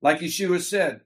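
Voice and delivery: ordinary talk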